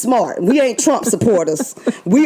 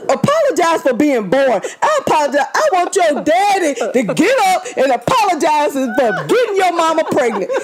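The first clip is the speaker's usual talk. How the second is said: laughing rant; 6 semitones higher than usual. Real, not acted. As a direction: rant while cracking up. Ranting with laughter about apology.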